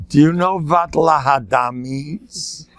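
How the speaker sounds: put-on slow, drawn-out Yiddish-accented question; Rav Hutner. Rabbi answering a worried father, slow Yiddish accent. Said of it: "rub in the accent".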